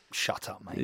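unvoiced curt shut-down; whispered dismissal. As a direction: hiss it softly as a brush-off. Imagined Martin dismisses him.

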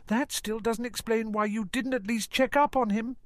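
sharp, high retort; snapping. Acted. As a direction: snap it at her. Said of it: "he snapped".

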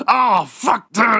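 shouted angry outburst; furious shout. Pretend. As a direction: yell it in disgust. Gym guy furious.

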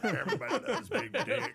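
high, laughing repeat. Acted. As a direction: laugh it out teasingly. Echoing the joke, laughing.

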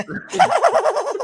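very high shrill cartoon laugh; high squeaky puppet giggle. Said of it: "That sounds like the spawn of Satan".

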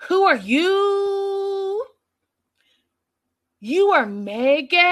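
high, drawn-out mock impression; Karen. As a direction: stretch the last word into a long, high wail. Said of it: "[name] does this Karen impression".

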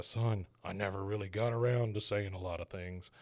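low, soft dying-father voice; Charlton Heston. Acted. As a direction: lightly act a movie patriarch's last words. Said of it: "Charlton says".

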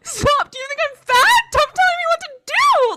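high, loud burst of indignation; mock outraged shout. Snapping back at babe over being told to lift, outraged shout. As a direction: yell it in exaggerated offence. Acted blow-up at a gym suggestion.

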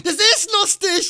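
high, disbelieving yelp that climbs well up; hammed-up shocked protest; retold outrage. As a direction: squawk it like you can't believe your ears. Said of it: "Und ich bin nur so".

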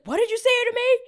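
high, loud mock outrage; imitated angry yell. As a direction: shout it like an affronted man. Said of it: "you guys are yelling at me".

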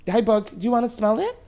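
high, sing-song voice for the dog; playful pet talk to a dog chewing something. Real, not acted. Talking to the dog.

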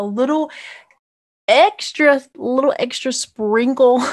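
playful. Acted. Teasing show notes bonus.

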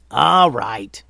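loud, exasperated shout; big, forceful call for order; yell. Acted. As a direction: yell to bring everyone to a halt. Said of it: "yelled Momo".

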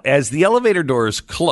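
ordinary delivery

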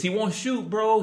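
eager, pressing bro; insistent. Retold or imitated. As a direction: push him, he won't shoot. Dad insisting on train.

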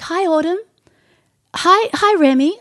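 high-pitched hellos to little ones; greeting children. Retold or imitated. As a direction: lift the voice, imitating a friendly hello.

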